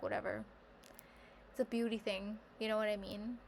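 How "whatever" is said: breezy dismissal. Retold or imitated. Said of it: "I'd be like".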